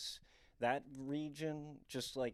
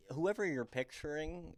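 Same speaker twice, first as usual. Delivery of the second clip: normal talking voice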